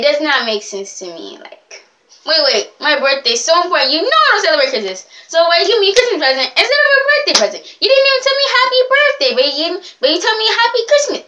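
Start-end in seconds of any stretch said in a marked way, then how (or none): 2.2-11.2 fast, climbing mock outrage; hammed-up indignant complaint; indignant rant. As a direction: protest faster and higher as you go. Acted complaint to present giver.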